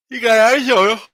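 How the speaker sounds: words stretched by a yawn. Real, not acted. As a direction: say it mid-yawn. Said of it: "as I yawn".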